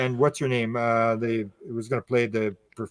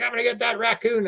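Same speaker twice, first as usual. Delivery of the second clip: high-pitched mock fury; retold angry threat; 9 semitones higher than usual. Owner with rifle vowing revenge, mock-angry threat. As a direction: imitate a worked-up swearer. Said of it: "he's swearing".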